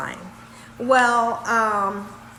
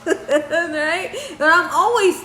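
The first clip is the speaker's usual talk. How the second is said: laughing; 5 semitones higher, slower than usual. Real, not acted. Laughing at herself.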